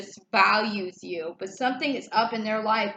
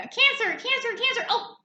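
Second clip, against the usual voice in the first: tripping card voice; 12 semitones higher than usual. Pretend. Reader voicing a tarot page that trips and falls, much higher calling. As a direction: play a clumsy page card falling over.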